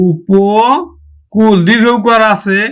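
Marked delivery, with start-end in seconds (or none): 0.0-1.6 উপকূল stretched very slowly; drawn out. Shown as a demonstration. Teacher stretching the word for students, very slowly. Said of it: "আমরা উচ্চারণ করব".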